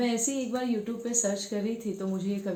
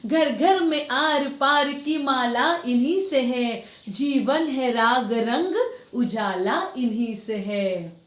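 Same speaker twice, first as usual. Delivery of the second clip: lilting verse, repeats lifted with more force; poetry recitation; 3 semitones higher, 5 dB louder, slower than usual. Acted. Ending the Ganga poem for viewers, emphatic recitation. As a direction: declaim the closing couplets with stretched words.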